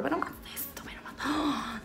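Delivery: hushed, awed whisper. Real, not acted. Admiring her own makeup to viewers: awed whisper. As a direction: whisper it like a secret marvel.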